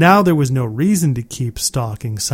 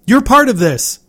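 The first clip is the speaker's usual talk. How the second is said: loud demand; 9 semitones higher, 5 dB louder than usual. A narrator's reading. Narrator confronts father, shouting.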